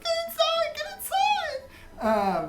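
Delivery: very high panicked crowd cry; mock screaming. People running from the horse, high panicked scream. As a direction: shriek it, stretching the last cry. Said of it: "like, screaming".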